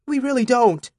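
high, urgent insistence. A narrator's reading. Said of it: "urgent enough to be convincing".

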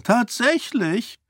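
high, slow incredulous "Tatsächlich"; mock surprise. King mocks priest, high slow disbelief.

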